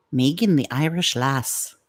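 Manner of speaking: put-on Irish lilt; Irish accent. Shown as a demonstration. Naming the listener as an Irish lass, in an Irish accent. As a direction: announce her in a playful Irish accent. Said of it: "have to say with the Irish accent".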